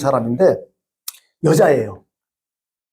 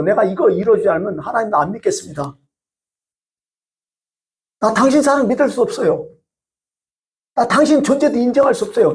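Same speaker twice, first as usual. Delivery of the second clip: defiant ultimatum; 5 semitones higher than usual. Retold or imitated. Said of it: "한마디 언파죠".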